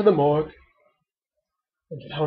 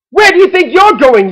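loud, high challenge from an angry mom; yelled; 12 semitones higher, 8 dB louder than usual. A narrator's reading. Mother to duckling leaving the house, yelling. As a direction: yell to stop them in their tracks. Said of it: "yelled Mother Duck".

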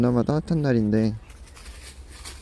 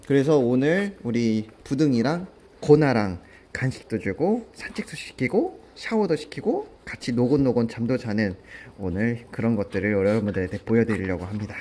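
usual speaking voice

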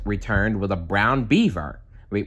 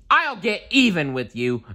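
yelled threat; 8 semitones higher than usual. A narrator's reading. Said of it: "Donald yelled".

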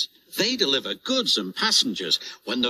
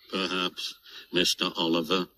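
shivering; 6 semitones lower, 4 dB quieter than usual. Acted. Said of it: "shivered Toad".

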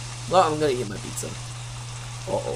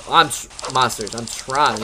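normal talking voice

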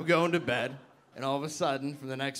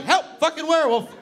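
high, loud cries for help; hammed-up terrified shouting; panicked yelling; 9 semitones higher, 7 dB louder, faster than usual. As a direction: yell the alarm in comic panic. Acts out screaming for help.

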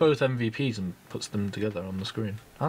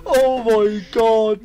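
high, slow laughing cry; amazed laughter; 7 dB louder, much slower than usual. Real, not acted. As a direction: laugh it out in disbelief. Amazed by teammate play.

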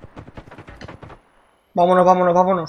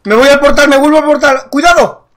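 high, loud panicked calls; urgent shouting; 8 semitones higher, 9 dB louder than usual. Real, not acted. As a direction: shout while running for cover. Retreating under fire.

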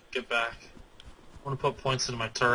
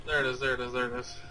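normal talking voice

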